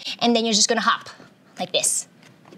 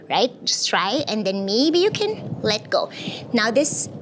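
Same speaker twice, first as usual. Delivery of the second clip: usual speaking voice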